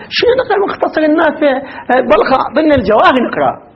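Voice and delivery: imitating a dismissive student; mock student scorn. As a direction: scoff like a student who wants bigger books. (سخرية طالب متعالٍ)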